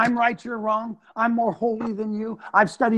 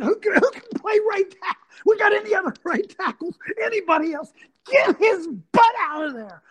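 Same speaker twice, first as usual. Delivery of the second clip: high, urgent yell for a sub; hammed-up coach hollering for another tackle; 12 semitones higher than usual. Coach yelling in huddle.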